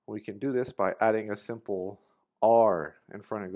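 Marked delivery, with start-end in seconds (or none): 2.4-3.0 are punched out much louder; stressed are. Shown as a demonstration. Teacher spotlighting the word are. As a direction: hit the word "are" hard and clear. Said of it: "just picture the letter R and say it".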